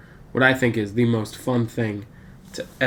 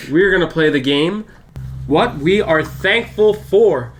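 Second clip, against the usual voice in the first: game-show announcing; 3 semitones higher than usual. Real, not acted. Host restarts the game after interruptions, announcing it emphatically.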